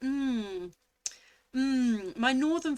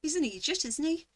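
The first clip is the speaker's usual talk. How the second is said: higher-pitched, lilting bad Irish accent; terrible Irish accent; 5 semitones higher, faster than usual. Quoting what you might say in Ireland, terrible Irish accent. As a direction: attempt an Irish accent, badly. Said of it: "That's a terrible accent".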